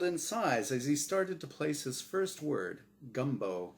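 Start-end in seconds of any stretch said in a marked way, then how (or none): none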